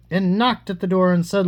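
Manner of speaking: plain reading voice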